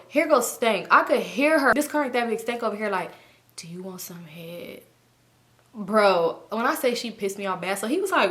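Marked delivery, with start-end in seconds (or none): 3.6-4.8 hushed, unvoiced whisper imitating her; mock furtive whisper; imitated whisper. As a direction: whisper a sly proposition, slowly. Mimics her overheard sly proposition.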